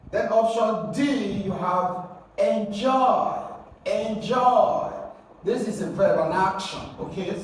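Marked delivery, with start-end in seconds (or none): 2.4-4.9 enjoy as en-JOY, JOY high and loud; slow enjoy with a strong, falling JOY; stress on second syllable. Shown as a demonstration. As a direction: model enjoy twice, hitting JOY hard. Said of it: "got to stress the second syllable".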